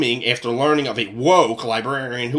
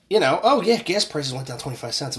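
usual speaking voice

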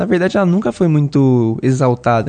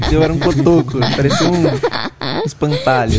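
laughing; 2 semitones higher than usual. Real, not acted. Laughing at her own childhood.

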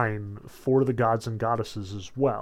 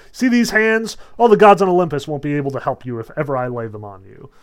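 loud, higher furious threats; furious Zeus; 4 semitones higher, 10 dB louder, faster than usual. Retold or imitated. Zeus furiously threatening Hera, loud shouting. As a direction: roar threats at her. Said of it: "Zeus is just floored".

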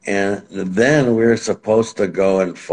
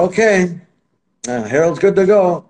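higher, upbeat okay; chirpy announcement; 9 semitones higher than usual. Retold or imitated. Manager cheerfully announcing his hurt fighter is fit. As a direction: sell it brightly to the officials. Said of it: "So I go".

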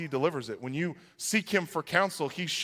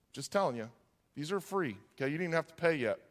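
ordinary talk

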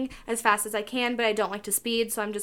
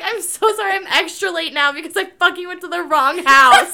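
laughing; 7 semitones higher, 9 dB louder than usual. Real, not acted. Laughing while retelling embarrassment.

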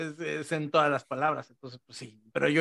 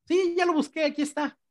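high mock local voice; 11 semitones higher than usual. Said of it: "O sea".

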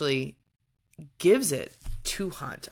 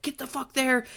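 mock urgent order; 10 semitones higher than usual. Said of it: "They're like".

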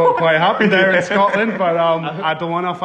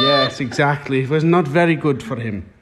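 put-on Irish newsreader accent; mock Irish bulletin voice; Irish news presenter. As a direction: deliver the headline as a hammed-up Irish anchor. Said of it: "we'll go over to Irish news".

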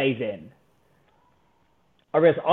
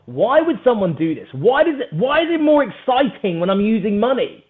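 higher-pitched, worked-up questioning; getting passionate; 7 semitones higher, 4 dB louder than usual. Real, not acted. Competitive guest asking himself why money excites, really passionate. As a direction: ask it with rising passion, punching the questions. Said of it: "I can feel myself getting really passionate".

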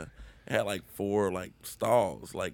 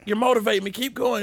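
louder, much higher eager reply; mock encouraged; 13 semitones higher, 6 dB louder, faster than usual. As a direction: egg the stranger on from the stall. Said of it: "I'm just in there like".

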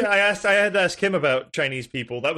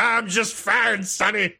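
put-on slow slurring drunk, a little higher; drunk guy voice; 5 semitones higher, slower than usual. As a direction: drawl it like a silly drunk. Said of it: "the most ridiculous drunk guy voice".

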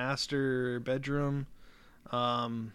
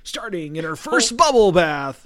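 announcer voice; 5 semitones higher, 14 dB louder, slower than usual. Acted. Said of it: "my announcer voice".

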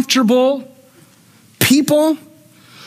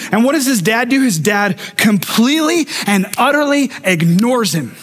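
normal talking voice

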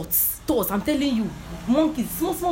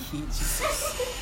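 startled cry; 5 dB quieter, much slower than usual. Retold or imitated. Uneasy feeling entering a church.

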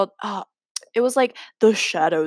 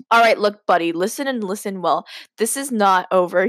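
plain reading voice

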